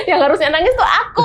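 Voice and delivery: quick, laughing complaint; amused retelling of a rebuke; laughing retort. Retold or imitated. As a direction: laugh while repeating the protest. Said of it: "aku bilang gitu".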